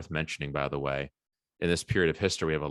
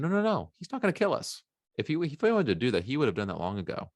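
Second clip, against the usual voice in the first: wife voice; 7 semitones higher, faster than usual. Retold or imitated. Wife voiced reassuring husband.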